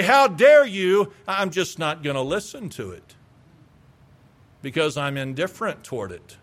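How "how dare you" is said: 缓慢而响亮的假装愤慨; slow, loud mock outrage, hamming up the offense; mock indignation. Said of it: "make me bow my shoulders back".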